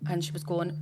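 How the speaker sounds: normal talking voice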